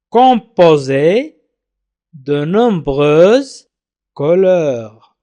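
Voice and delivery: very slow, drawn-out French reading; slow dictation-style reading. Shown as a demonstration. Teacher reading the French text to learners, slow and deliberate. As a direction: read it phrase by phrase, stretching the syllables.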